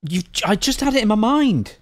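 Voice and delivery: high, frustrated, straining for a name; exasperated, the word on the tip of the tongue; frustrated recall. Real, not acted. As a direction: reach for a name that won't come.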